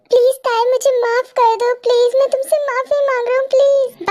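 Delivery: high, insistent begging for forgiveness; pleading. Acted. Man begging Tae to forgive him, pleading. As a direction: plead urgently, pushing each please.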